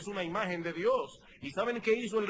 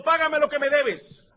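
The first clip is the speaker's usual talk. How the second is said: higher, louder harsh demand; demanding payment; 7 semitones higher, 8 dB louder than usual. Retold or imitated. Servant choking his fellow servant for money, angry. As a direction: squeeze the debt out of him.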